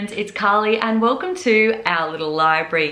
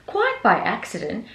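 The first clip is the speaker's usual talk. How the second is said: ordinary delivery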